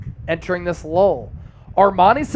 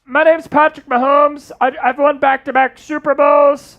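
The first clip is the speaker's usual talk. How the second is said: Patrick Mahomes impression; 6 semitones higher than usual. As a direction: squeeze it out like Mahomes bragging. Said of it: "that was actually pretty good".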